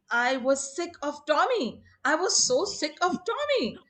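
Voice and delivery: mock-exasperated plea, pitched high and climbing; exasperated confession. Norina explains her theft to Baba, mock exasperation.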